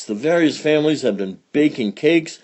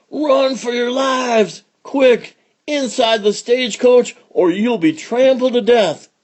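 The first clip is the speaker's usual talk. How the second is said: high-pitched yelled warning; panicked yell; 12 semitones higher than usual. Acted. Gang boss warns everyone of the oncoming stampede, high yell. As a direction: yell a frantic warning, much higher than usual. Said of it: "yelled Stewie Stinker".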